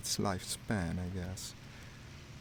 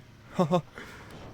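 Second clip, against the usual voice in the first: sudden airy laugh, higher and louder; breathy laugh; 9 semitones higher, 5 dB louder than usual. Real, not acted. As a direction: burst out laughing at your own blunder. Shot a friendly by mistake.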